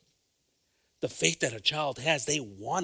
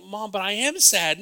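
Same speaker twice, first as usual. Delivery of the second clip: imitating the child; 9 semitones higher, 9 dB louder than usual. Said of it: "And the child's like".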